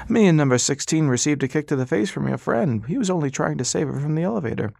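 patient, polite, in no hurry; old doctor. Acted. Old doctor to silent Ras, high and courteous.

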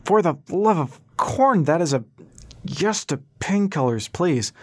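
high, slow, woozy pained cry; half-scream the plea, words dragged out. A narrator's reading. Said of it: "still half screaming".

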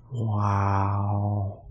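WOW stretched long on a level tone; a slow, exaggerated WOW, every sound drawn out; over-enunciated. A narrator's reading. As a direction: draw out WOW in dazed awe. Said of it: "he said, over-enunciating".

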